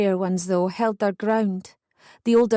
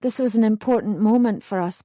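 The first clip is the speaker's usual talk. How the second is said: ordinary delivery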